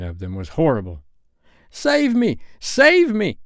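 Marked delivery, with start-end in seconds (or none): 1.7-3.4 desperate cry, much higher and louder; Nibs crying out. A narrator's reading. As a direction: shriek for help as the wolves close in. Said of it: "cried Nibs".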